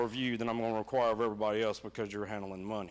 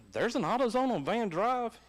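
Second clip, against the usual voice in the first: imitated surprise; 8 semitones higher than usual. Son surprised at store location.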